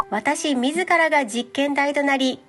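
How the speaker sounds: ordinary delivery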